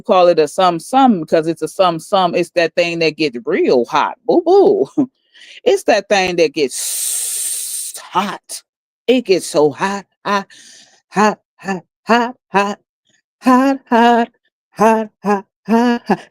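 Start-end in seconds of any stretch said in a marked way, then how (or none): 4.3-5.1 high mock coo. Mocking Peaches' words. 5.6-16.3 higher, sing-song mocking hot hot; mocking singsong. Mocking Peaches about the pipe.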